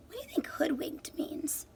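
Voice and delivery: unvoiced whispered question. Real, not acted. Teacher asking the class about hoodwinked, whispered. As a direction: whisper it as a puzzle.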